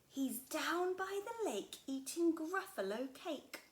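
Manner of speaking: a tricky snake sending her away; sly snake. Acted. Snake answers child.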